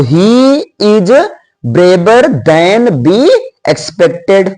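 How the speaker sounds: slow, deliberate dictation; a teacher reading out the right answer slowly; corrected sentence, very slow. Shown as a demonstration. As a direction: dictate the correct version word by word. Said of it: "पहला number more से नहीं बनेगा".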